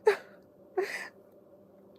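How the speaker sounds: slow, high words through laughing tears; laughing cry. Retold or imitated. Answering Steve about the noise, slow high laughing wail. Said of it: "I'm like".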